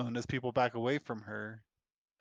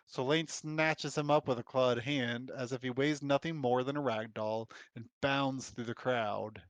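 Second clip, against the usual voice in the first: ordinary talk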